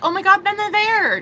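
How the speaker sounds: high-pitched mock-amazed gush; mock amazement. Acting out the imagined breakthrough.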